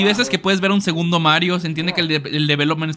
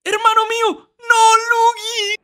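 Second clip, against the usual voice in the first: high-pitched hammed-up anguished cry; melodramatic wailing. Player crying out to brother Luigi, mock anguish. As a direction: wail for a brother, drawn out at the end.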